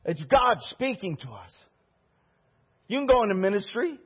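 ordinary talk

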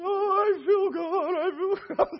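high, stretched-out tearful cry; imitated crying wail. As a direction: wail it slowly at full voice, mimicking her. Acts out overwhelmed woman again.

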